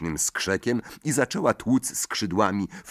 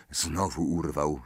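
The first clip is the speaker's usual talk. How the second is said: usual speaking voice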